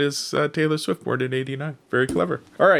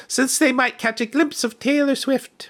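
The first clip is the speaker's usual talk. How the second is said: higher mom voice, lightly mocking; 10 semitones higher than usual. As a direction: voice the mom line up high. Mom's line voiced in cartoon.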